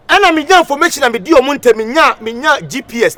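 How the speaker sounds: loud, high ranting; shouting. Acted. Hype man ranting loudly to the crowd. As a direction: rant loudly.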